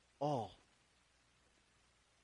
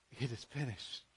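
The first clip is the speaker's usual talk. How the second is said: much softer, lower, almost breathed; hushed and low; 7 semitones lower, 13 dB quieter than usual. Retold or imitated. Preacher echoing Jesus' dying words, hushed and low. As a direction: let it fall away quietly, like a last breath. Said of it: "he was saying".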